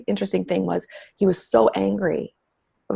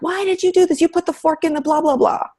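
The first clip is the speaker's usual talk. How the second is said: high, imitated scolding; scolding mom; 10 semitones higher than usual. Mom scolding one kid over the fork, high mock scold. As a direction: tell the kid off, half-mocking yourself. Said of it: "I'll get on one kid".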